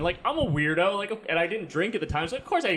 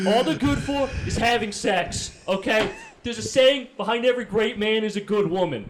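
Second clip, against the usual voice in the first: put-on New Jersey accent; 6 semitones higher, 3 dB louder than usual. Dad giving son sex advice in New Jersey accent. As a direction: preach it like a brash Jersey father. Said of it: "I also gave him a New Jersey accent".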